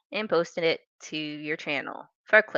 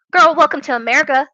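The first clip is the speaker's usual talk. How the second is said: quick, loud and higher, mocking; sassy retort; 7 semitones higher, 8 dB louder, faster than usual. Real, not acted. Creator mocking a critic, sassy and loud. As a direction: toss it off like it's obvious.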